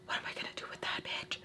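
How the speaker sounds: frightened inner whisper, fast; scared whisper. Retold or imitated. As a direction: hiss it under your breath, panicked. Scared thought in the car.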